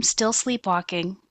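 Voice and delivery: normal talking voice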